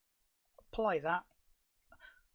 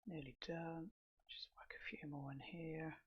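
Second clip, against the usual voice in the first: whispered aside; 10 dB quieter than usual. Real, not acted. Muttered aside while clicking points.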